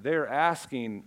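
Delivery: ordinary delivery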